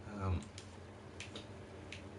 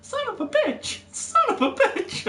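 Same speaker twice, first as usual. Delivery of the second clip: put-on much higher, laughing toddler voice parroting a swear; imitating toddler; 5 dB louder than usual. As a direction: play the three-year-old copying dad, giggling. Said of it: "my three-year-old in the back starts saying".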